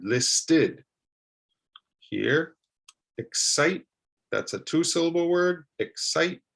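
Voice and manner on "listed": "listed" broken into LIS-TID; "listed" with a fully voiced "tid" ending; two distinct syllables. Shown as a demonstration. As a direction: say "listed" in two deliberate beats. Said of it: "into a two-syllable word".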